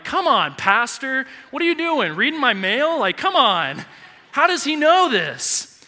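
high, loud mock outrage at being found out; exasperated protest. Young listener feeling singled out by the pastor, exasperated protest. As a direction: cry out in comic disbelief, pitch leaping.

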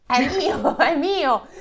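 high, loud eager claim. Acted. Three hairdressers claiming the hair, high eager call. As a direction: grab the prize eagerly. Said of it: "dissero Tony, Rufus e Luis".

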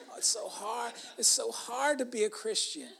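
lightly mimicked complaint, softened; gently mock someone feeling sorry for themselves. Preacher imitating struggling Christians to his congregation, lightly mock complaining.

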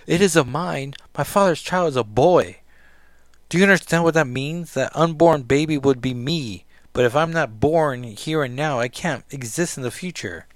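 loud, impassioned acted protest; distraught outburst. Rachel explaining her doom to Kitty, distraught outburst.

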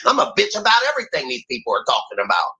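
high-pitched mocking complaint; whiny grown-up kid. Mimicking grown-ups whining about new technology, high mocking voice. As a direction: play an offended older grown-up throwing a childish fit. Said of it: "acting like the fucking grown-ass kid".

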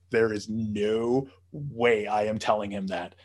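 slow, emphatic retold refusal; low, drawn-out "no way"; wide-eyed refusal. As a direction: imitate the kid refusing, word by weighty word. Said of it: "the kid's eyes got really big".

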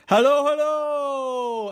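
high, ringing, drawn-out echo. Acted. Empty house answering Rose, long high echo. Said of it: "echoed back".

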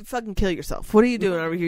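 ordinary talk, nothing marked

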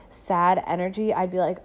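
ordinary talk

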